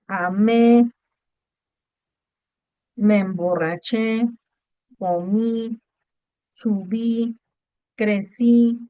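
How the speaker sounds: slow, each verb set apart, stress on the last syllable: amé, comí, subí, crecí; stressed final syllables. Shown as a demonstration. Teacher listing past-tense verbs for the class, slow and deliberate. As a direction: spell out the accented endings one by one, slowly. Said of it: "se acentúan los verbos en tiempo pasado simple".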